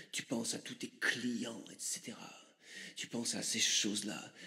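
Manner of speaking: low, hushed mystic guru; Chaman Chorizo. Acted. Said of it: "je vais te laisser avec, euh, Chaman Chorizo".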